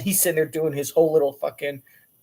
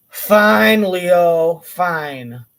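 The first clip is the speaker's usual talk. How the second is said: exasperated give-in; 8 dB louder, much slower than usual. Retold or imitated. Exasperated giving in to Leo.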